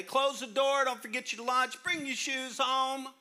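loud high nagging parent. Pretend. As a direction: yell the chores like a frazzled parent. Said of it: "give 'em a tip of the day".

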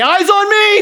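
high, loud call of "Eyes on me!"; instructor's shout. Retold or imitated. Karate instructor calling his class to attention, loud shout. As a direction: bark it like a karate teacher at the front. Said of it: "the instructor would get in the front of the class and say".

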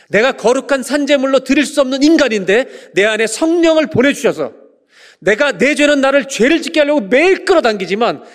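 high, loud, fervent preaching climax; preaching at full force. Acted. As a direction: build it like a revival sermon peak. (높고 크게 외치는 설교)

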